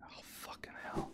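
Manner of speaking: barely audible, unvoiced mutter; whispered curse. Real, not acted. Player after failing, whispered curse. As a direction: breathe it out to yourself.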